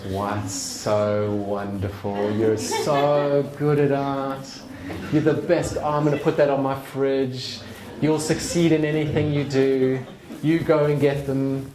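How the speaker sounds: slow, high, doting mum praise; gushing mum. Retold or imitated. Mum praising the fridge drawing, slow gushing. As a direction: gush over it like a proud mum.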